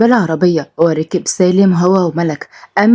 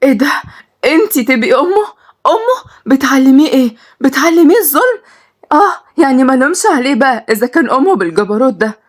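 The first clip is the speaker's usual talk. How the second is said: outraged; 7 semitones higher than usual. A narrator's reading. Girl accusing the mother of teaching cruelty. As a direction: hurl the accusation at her.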